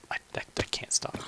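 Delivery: hushed confessing whisper. Real, not acted. Gamer admitting he can't stop playing, hushed whisper. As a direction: whisper it like a guilty secret.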